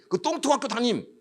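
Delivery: high mocking sneer at a lowly school; retold policeman's scornful jab; sneering cop. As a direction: say it with contempt. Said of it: "그 경찰이 나한테".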